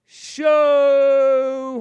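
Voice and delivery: long, loud, high SHOW; hammed-up big-voiced SHOW, drawn out; mock manly call. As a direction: belt out SHOW and hold the vowel. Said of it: "trying to sound all manly".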